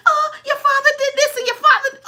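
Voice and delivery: put-on high, loud nagging whine of an old mother; mother's complaint. Daughter mimics her seventy-year-old mother, nagging high whine. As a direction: whine it like a mother who won't let go. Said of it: "she'd be like".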